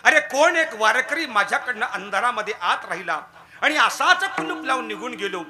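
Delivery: priest crying out in anguish at midnight; loud lament. Acted. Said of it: "ओरडले मोठ्यानं".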